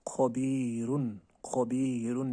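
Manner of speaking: खबीरुन with a full, heavy back "kho"; slow Quran-style recitation of खबीरुन; heavy kho sound. Shown as a demonstration. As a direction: draw out खबीरुन slowly, thick kh. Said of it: "खो ज़बर खो".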